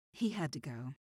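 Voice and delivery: offhand and matter-of-fact, as if it meant nothing; casually dismissive about a killing. A narrator's reading. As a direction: toss it off like taking out the trash. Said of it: "like she was talking about taking out the trash".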